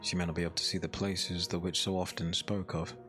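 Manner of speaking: usual speaking voice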